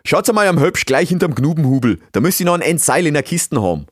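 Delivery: loud, high instructions in dialect; bossy beaver master. Acted. Beaver master sends assistants for rope, loud and bossy. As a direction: call out directions to helpers.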